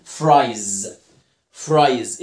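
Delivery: "فرايز" ending in a buzzed z; final z sound. Shown as a demonstration. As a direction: finish "فرايز" on a held, voiced z. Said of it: "والإس بنطقها زي".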